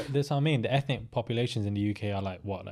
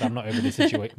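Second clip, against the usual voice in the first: emphatic; 14 semitones higher, 5 dB louder than usual. Retold or imitated. Retold annoyed quote.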